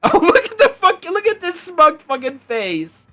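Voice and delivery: real delight at the cat's face; delighted gushing. Real, not acted. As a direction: gush over the smug cat. Gushing over the cute cat.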